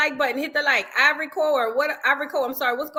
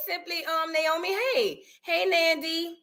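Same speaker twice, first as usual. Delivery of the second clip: ordinary talk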